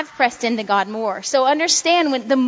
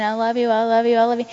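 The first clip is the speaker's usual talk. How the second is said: mock devotion; faster than usual. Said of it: "rocking back and forth saying".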